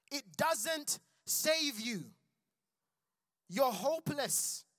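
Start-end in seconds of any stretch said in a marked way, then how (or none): none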